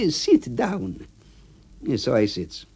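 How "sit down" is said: high voice for A B. Acted.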